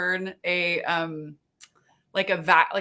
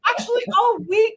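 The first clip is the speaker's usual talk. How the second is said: high, excited squeal of delight; 14 semitones higher, 5 dB louder than usual. Retold or imitated. Cashier topping her own praise, still squealing. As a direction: keep squealing in happy disbelief. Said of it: "this big old scream".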